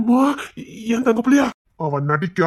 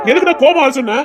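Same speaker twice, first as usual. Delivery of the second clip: angry demand; 5 semitones higher, 5 dB louder than usual. Acted.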